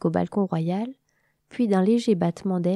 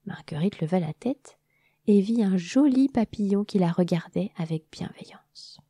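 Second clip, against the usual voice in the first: usual speaking voice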